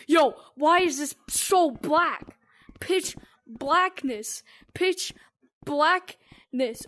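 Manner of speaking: slow awed. Real, not acted. Dark map, slow awed reaction.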